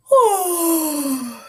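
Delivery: open-throated yawn sigh sliding down; a nice little yawn, throat wide open. Shown as a demonstration. Teacher demonstrates a yawn to open the throat, open sigh. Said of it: "do a nice little yawn like this".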